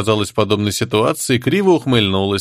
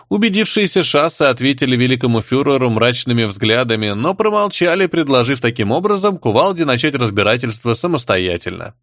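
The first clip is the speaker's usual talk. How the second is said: plain reading voice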